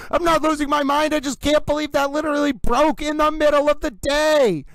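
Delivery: high-pitched excited disbelief; losing his mind. Real, not acted. Streamer answers chat saying he's losing his mind, worked-up disbelief. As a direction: protest in high, astonished excitement. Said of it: "[name]'s losing his mind".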